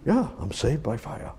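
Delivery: ordinary delivery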